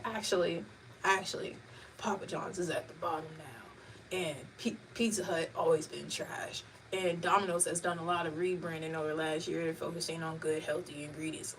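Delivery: put-on deadpan older brother explaining the facts; expressionless know-it-all. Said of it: "No expression on his voice".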